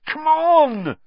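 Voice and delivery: high, loud frantic shout; desperate shout at a statue. Acted. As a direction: yell at the stone gargoyle. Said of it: "Harry shouted at it".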